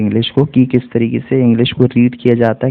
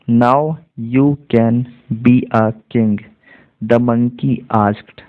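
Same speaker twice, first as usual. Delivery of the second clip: slow teacher's reading, pausing between words; much slower than usual. Shown as a demonstration. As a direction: spell out the sentence word by word. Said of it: "word by word".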